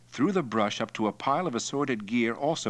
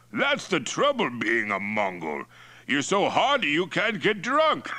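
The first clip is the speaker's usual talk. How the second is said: tipsy complaint climbing high and loud; Mongol chief Toktai, drunk; 9 semitones higher, 4 dB louder than usual. Acted. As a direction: hold forth as the drunken chief.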